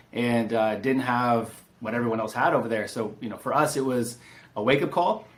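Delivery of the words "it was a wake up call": ordinary delivery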